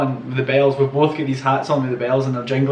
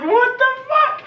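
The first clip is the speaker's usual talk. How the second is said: shouted high, slow what the fuck; stunned disbelieving shout; slower than usual. Retold or imitated. Seeing his mate bet a foot race with the driver, shocked shout. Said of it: "And I was like".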